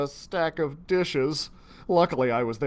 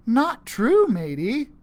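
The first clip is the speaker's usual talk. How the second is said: put-on pirate kid; 11 semitones higher, 9 dB louder, slower than usual. Gary voiced as pirate kid.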